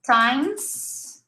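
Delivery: Times softly, ending on a long S; demonstrated pronunciation. Shown as a demonstration. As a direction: say Times with a held hiss. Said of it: "this word like this".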